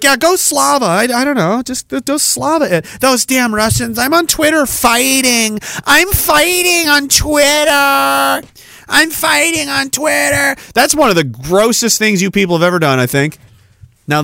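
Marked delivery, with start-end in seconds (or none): 0.0-5.6 high, mocking voice of a smug Twitter warrior; hammed-up sneer at armchair fighters; mocking online supporter. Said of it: "And you're like". 5.8-8.5 higher, slow mocking yell; mocking shout. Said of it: "And you're like". 8.9-10.6 high, mocking voice of a smug Twitter warrior; hammed-up sneer at armchair fighters; mocking online supporter. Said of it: "And you're like".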